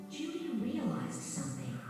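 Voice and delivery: ordinary delivery, nothing marked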